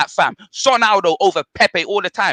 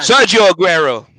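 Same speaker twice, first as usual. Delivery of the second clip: loud announcement; 6 dB louder than usual. Pretend. Panellist naming his striker on the mic, loud announcement. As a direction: announce the name loudly.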